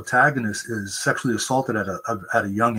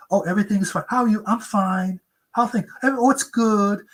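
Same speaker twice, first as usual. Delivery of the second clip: fake cheerful; 9 semitones higher than usual. Mimicking the fake happy face.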